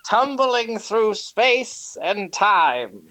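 epic movie trailer narrator; grand booming space narration. Acted.

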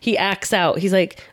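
normal talking voice

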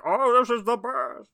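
Bane voice. Retold or imitated. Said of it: "I was like".

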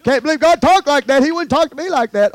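high, loud mimicked disbelief; mocking objector. Preacher mimics someone doubting God would say it, mocking.

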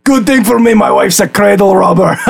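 high-pitched little creature, joking; talking homunculus. Acted. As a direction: crack a joke in a small creature's high voice. Homunculus jokes about its age.

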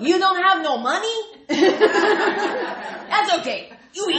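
high, loud, laughing Greek aunt; Aunt Vula voice. Retold or imitated. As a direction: fuss over a guest like a Greek auntie. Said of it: "I hear Aunt Vula's voice from Big Fat Greek Wedding".

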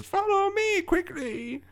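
put-on high, slow old woman; creaky elderly woman's urging. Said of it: "and she says".

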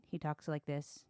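flat, low monotone imitation. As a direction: drone it out like a bored rider. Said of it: "he's very monotone".

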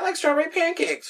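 put-on high, small child's voice; little sister voice. A friend's little sister telling the Furbies what she likes, in a high childlike voice. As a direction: chirp it like a little girl. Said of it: "his little sister was like".

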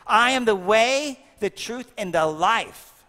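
slow, ringing proclamation of a holy saying; solemn proclamation. Acted. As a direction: proclaim it like scripture from the pulpit. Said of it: "Jesus says this".

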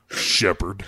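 lightly voiced gruff soldier saying Shepard; a hint of a game character calling Shepard; Rex from Mass Effect. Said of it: "Rex from Mass Effect two".

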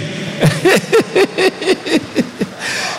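high, drawn-out run of laughter; long giggling laugh. Real, not acted. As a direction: giggle on and on in amusement. Laughs about grandfather reading.

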